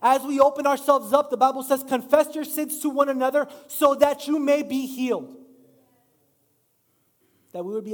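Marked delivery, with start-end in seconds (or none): 0.1-5.3 louder, higher preaching on confession; preaching build. Real, not acted. Pastor urging the church to confess, loud preaching. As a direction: lift it up to the whole room. Preaching climax quoting the Bible.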